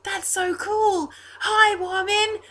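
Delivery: put-on thrilled high-pitched boy, stretching the words; [name]. Altar boy greeting the bird, high slow excitement. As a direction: burst out loud, then coo slowly in a child's high voice. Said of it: "twelve-year-old-ish looking blonde altar boy".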